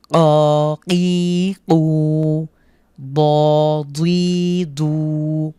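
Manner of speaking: each syllable's vowel stretched long and slow; drawn-out recitation. Shown as a demonstration. Teacher shows students how to stretch the vowels. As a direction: chant the syllables, holding every vowel one alif. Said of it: "এক আলিফ টেনে পড়তে হবে".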